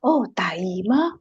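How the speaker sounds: ordinary talk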